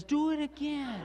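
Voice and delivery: put-on high-pitched excited child voice; eager little boy. Little boy swallows penny and asks Dad, eager. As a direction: imitate a delighted small child's demand. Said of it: "he grabs a penny and swallows it and says".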